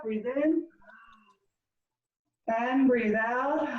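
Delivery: slow, calm breathing cues; guided breathing. Acted. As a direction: guide them through each breath. Leads breathing exercise.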